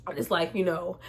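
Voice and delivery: ordinary talk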